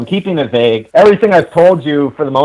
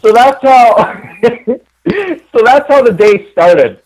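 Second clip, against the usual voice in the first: laughing; 8 semitones higher, 3 dB louder, slower than usual. Real, not acted. Laughing while wrapping up mishap.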